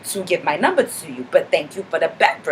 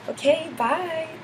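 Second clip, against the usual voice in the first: chirpy sign-off; 9 semitones higher, 7 dB quieter, slower than usual. Real, not acted. Signing off the vlog.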